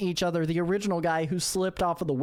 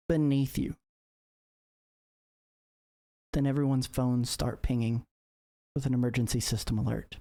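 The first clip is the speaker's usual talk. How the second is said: ominous narration; 5 semitones lower than usual. Acted. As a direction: drop into a foreboding storyteller hush. GM ominous scene setting.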